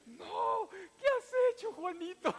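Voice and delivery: very high, loud wail with laughter breaking through; mock anguished cry. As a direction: cry out as if the world ended, half laughing. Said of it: "y me dijo".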